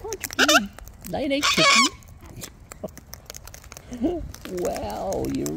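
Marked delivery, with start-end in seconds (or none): 0.0-1.8 high, louder excited coo. Real, not acted. Owner cheering on her laying bird, excited. 2.7-4.4 quiet breathy chuckle; soft laugh. Real, not acted. Owner laughing softly at her bird.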